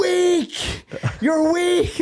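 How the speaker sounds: hammed-up high, scornful taunt; Tate. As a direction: imitate a motivator berating the viewer, shrieking high. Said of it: "cuts to Tate, and he's like".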